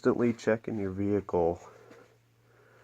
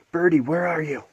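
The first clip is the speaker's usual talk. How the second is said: high, loud coaxing call; calling a bird; 7 semitones higher, 6 dB louder than usual. Real, not acted.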